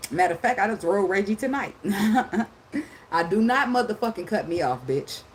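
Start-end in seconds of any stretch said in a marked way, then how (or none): none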